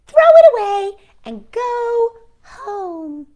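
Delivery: high, slow, loud bird taunt; jeering robin shout. Acted. Robins shouting at Toad to quit, high loud and slow. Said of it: "said the robins".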